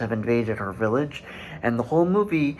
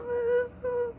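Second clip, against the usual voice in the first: very high but much softer infant cry; fading baby cry; 7 dB quieter than usual. Retold or imitated. The baby's final cry, high and faint. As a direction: let the last cry come out weak and fading. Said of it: "and you just hear".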